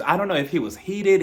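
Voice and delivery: normal talking voice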